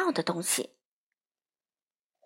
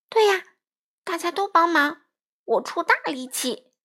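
high, louder eager squawk; cawing eagerly; 7 semitones higher, 3 dB louder than usual. Acted. Crow eagerly promises mom that everyone will help. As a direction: chirp it out like an excited little crow. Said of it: "花袜子呱呱叫道".

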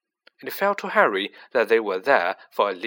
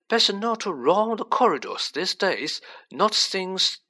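put-on higher, grave old wizard; Dumbledore voice; 8 semitones higher than usual. A narrator's reading. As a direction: warn him gently, then trail off.

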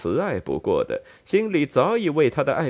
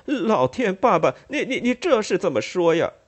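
much higher, embarrassed half-laughing stammer; a mortified son protesting with a nervous laugh; flustered cry; 8 semitones higher than usual. Acted. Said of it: "乔瑟夫嚷道".

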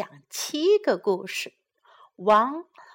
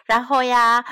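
ordinary delivery, nothing marked

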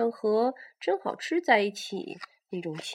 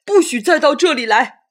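loud angry command, higher; battle roar; 7 semitones higher, 17 dB louder than usual. Acted. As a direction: roar the warning. T-rex drives attacker away.

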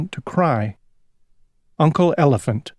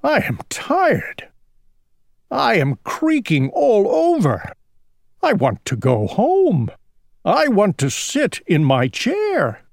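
put-on high, whiny old king; creaky old king grumbling for home; weary complaint; 6 semitones higher than usual. A narrator's reading. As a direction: complain wearily, pitch climbing.

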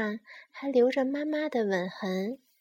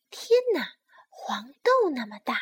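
astonished sparrow; 3 dB louder than usual. Acted. Sparrow marvels at little bear's huge tears, astonished. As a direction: exclaim in mock astonishment as the sparrow.

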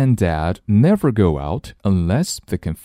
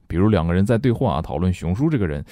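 usual speaking voice

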